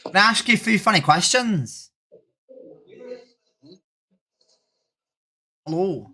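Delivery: put-on Irish accent greeting; chirpy fake Irish brogue. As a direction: say hello like a cheery Irish local. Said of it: "Irish accent for the rest of the stream".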